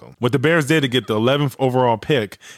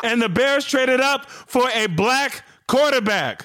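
much higher, slower, still thrilled; still excited; 12 semitones higher than usual. Real, not acted. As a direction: ride the excitement down. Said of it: "I'm so excited".

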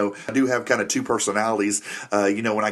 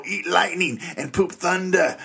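quoting the trainer line; 7 semitones higher, slower than usual. Retold or imitated. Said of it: "I'm kinda that trainer guy".

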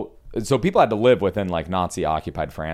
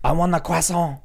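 higher, hammed-up French accent; mock French accent; 10 semitones higher than usual. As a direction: whine it as a homesick French exile. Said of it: "Could you imagine being French".

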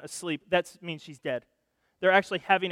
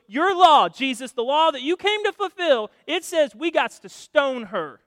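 loud, higher-pitched accusation; accusing demand; 5 semitones higher, 6 dB louder than usual. Acted. Pharisees confronting Jesus over the woman, accusing demand. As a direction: challenge him forcefully, leaning on the law.

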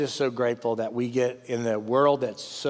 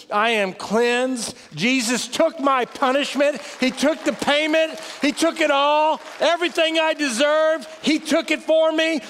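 rising, urgent sermon delivery; full-force preaching; 12 semitones higher, 5 dB louder than usual. Acted. Pastor preaching to his congregation, building fervour.

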